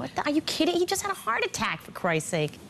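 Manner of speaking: high-pitched, incredulous outburst; outraged disbelief. Retold or imitated. Daughter seeing hospital food served to her father, outraged high-pitched disbelief. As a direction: voice leaps up in indignant shock. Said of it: "and I'm thinking".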